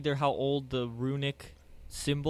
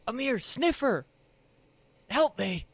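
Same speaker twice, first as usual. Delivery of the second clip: calling for help; 10 semitones higher than usual. Acted. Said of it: "I'm trying to leap out and go".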